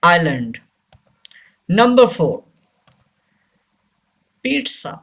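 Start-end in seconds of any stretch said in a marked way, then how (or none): 0.0-0.6 "island" said as eye-lund; silent S. Shown as a demonstration. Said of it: "Say this word". 4.5-4.9 "pizza" said as peet-sa; model pronunciation. Shown as a demonstration. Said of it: "आपकी आसानी के लिए".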